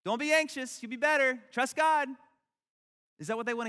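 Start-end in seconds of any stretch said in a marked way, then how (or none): 0.0-2.1 high, loud chirpy advice; hammed-up churchy pep talk; mock preachy cheer. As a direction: brush off their anxiety with a bright cliché. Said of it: "stand over here and say".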